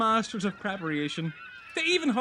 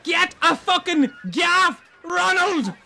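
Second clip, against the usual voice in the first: urgent shout; 5 semitones higher, 5 dB louder, slower than usual. Acted. Fisherman fighting big catch.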